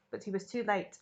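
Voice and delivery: ordinary delivery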